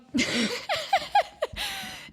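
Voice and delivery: high-pitched burst of real laughter. Real, not acted. Laughing at how silly the scary tunnel was. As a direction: laugh it out, helpless.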